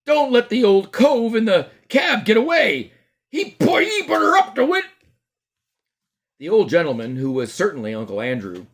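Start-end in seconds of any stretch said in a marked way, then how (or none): 0.1-4.9 high, loud crowd yell; called out. Acted. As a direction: shout warning across the street. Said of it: "someone else called out".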